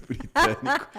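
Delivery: agudo e alto entre risos; rindo. Real, not acted. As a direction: solta a palavra rindo. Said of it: "Seu sotaque". (ironia rindo do próprio sotaque)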